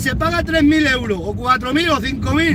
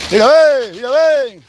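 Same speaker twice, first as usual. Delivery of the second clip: louder call across the field; calling out to cows; 5 dB louder, slower than usual. Real, not acted. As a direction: call the cow over. (llamando a las vacas en voz alta)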